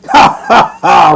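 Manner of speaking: loud hearty laugh; roaring laugh. Acted. Old father roaring with laughter at the basket. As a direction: throw your head back and roar. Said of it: "roared Toby".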